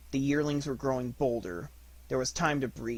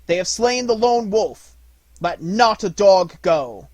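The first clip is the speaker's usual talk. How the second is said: high, loud order to the pack; shouting; 8 semitones higher, 12 dB louder than usual. A narrator's reading. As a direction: yell for vengeance. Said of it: "shouted Mowgli".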